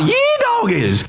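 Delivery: high whooping yee-haw yell; mock triumphant shout. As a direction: belt it out like a rodeo cheer. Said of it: "I want you to shout".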